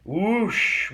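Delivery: loud, high whoosh. Acted. Reading the coaster ride aloud, loud high whoosh. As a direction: let it rush out like the wind.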